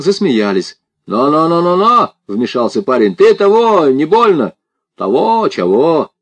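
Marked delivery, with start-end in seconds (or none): 1.1-2.1 high, rising rapped-out objection; warning interruption. A narrator's reading. Village lad butts into the banter, warning loud and high. 3.1-4.5 loud, high challenge; blustering warning. A narrator's reading. Village lad squares up to Sasha, blustering loudly. 5.0-6.1 mocking echo, high then dropping; mimicking. A narrator's reading. Sasha mimics the village lad's warning, mocking. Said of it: "передразнил его Саша".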